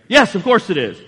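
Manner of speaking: loud, indignant retort; a loud mock-indignant Jonah; Jonah insisting. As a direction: defend yourself hotly as Jonah. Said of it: "Jonah says, like we would".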